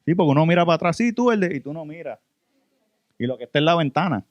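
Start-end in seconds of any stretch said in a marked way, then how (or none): 1.0-1.5 higher voice, calling someone over. Retold or imitated. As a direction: imitate the man calling him out of the bathroom. Imita al hombre llamándolo. (llamando a alguien en voz más aguda)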